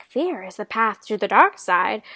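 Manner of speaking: lightly hint at Yoda's solemn chain of warnings; quoting Yoda. Retold or imitated. Yoda warning young Anakin about fear, lightly voiced Yoda. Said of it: "and he's like".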